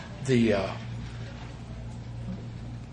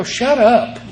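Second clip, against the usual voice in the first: higher, louder, laughing scolding; exasperated outburst; 6 semitones higher, 4 dB louder, slower than usual. Retold or imitated. Sponsor losing patience with a talkative sponsee, laughing scold. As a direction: snap at the sponsee, half laughing. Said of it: "I go".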